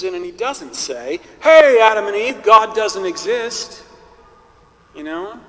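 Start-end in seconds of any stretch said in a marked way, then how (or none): 1.4-3.8 mock devil hailing. Devil pitching outright denial.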